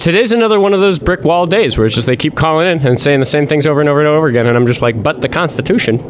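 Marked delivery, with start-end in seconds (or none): 5.0-6.0 mock-timid, slightly higher objection; meek pleading. Host to stubborn callers, meekly pleading. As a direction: plead politely like an ignored objector.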